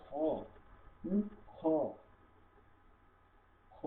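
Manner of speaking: খ scraped in the throat; throat-rubbed kho. Shown as a demonstration. Teacher demonstrates খ, rasped in the throat. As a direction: rub the throat on খ. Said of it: "গলা ঘষে উচ্চারণ করতে হবে".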